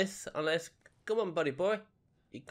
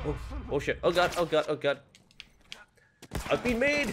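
panic; 7 semitones higher, 7 dB louder than usual. Real, not acted. Spotted by enemy, panicking.

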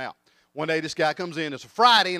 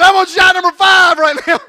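very high, very loud yell; horrified mock shout; 13 semitones higher, 17 dB louder than usual. Billy realizes he is shooting the pet, panicked mock shout.

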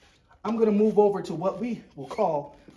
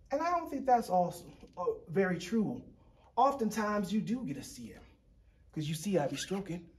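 usual speaking voice

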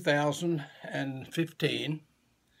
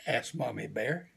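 ordinary delivery, nothing marked

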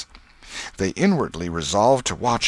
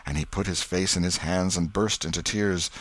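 plain reading voice

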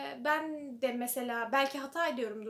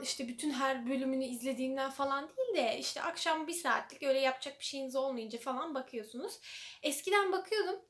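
ordinary delivery

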